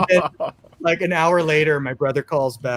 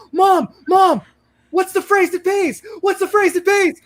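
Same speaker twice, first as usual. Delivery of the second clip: much higher, louder frantic shout to mom; frantic child calling; 3 dB louder than usual. Retold or imitated. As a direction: yell for mom in a panic. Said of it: "I put down the phone".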